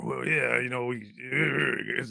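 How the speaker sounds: lightly deepened, slow Pacino voice; imitating a deep voice. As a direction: drop into a slow low drawl. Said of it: "he talked very deep".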